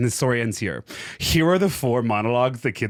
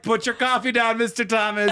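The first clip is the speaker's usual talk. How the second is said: much higher student voice; imitated scolding kids; 10 semitones higher than usual. Students telling teacher to drop coffee, mock scolding. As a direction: pitch it up as a kid managing the teacher.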